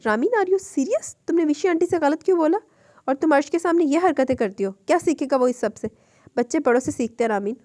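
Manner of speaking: high, loud scolding of a younger sister; gently cross. Acted. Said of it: "Hurain नरम मगर थोड़ा गुस्से से बोली". (नरम मगर थोड़े गुस्से से डाँटना)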